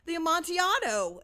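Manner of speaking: high, loud startled exclamation; Fortunato's astonished cry. A narrator's reading. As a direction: burst out in shocked disbelief. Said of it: "ejaculated my friend".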